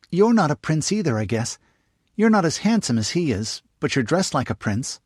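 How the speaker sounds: put-on high, eager little girl; young girl voice. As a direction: pitch it up like a curious young girl. Narrator voices young girl Jane.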